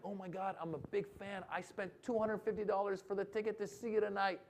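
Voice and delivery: high, hushed voice of an excited fan; gushing fan. Retold or imitated. Fan gushing before pricey show.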